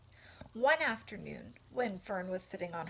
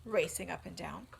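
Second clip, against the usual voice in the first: ordinary delivery, nothing marked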